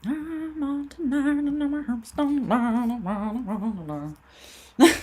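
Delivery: high nasal singing voice. Retold or imitated. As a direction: sing it high through the nose. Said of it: "The nasally voice".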